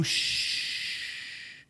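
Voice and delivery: a sharp unvoiced shush; hushing shh. Pharisee hushing noisy disciples with a sharp shush. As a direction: hush the crowd like a stern usher. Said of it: "He said".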